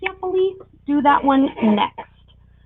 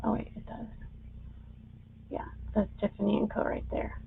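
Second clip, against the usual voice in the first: whispering; 15 dB quieter, faster than usual. Real, not acted. Reading a small marking up close.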